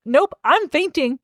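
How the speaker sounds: high, slow feigned faint; mock swoon. Hermione dodging Draco's questions, mock swoon. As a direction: draw it out high, as a mock escape.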